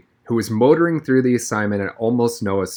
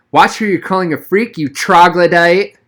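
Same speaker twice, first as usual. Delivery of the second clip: put-on angry girl; 7 semitones higher, 7 dB louder than usual. A narrator's reading. Chloe defending Noah, snapping at Donovan. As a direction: put him in his place.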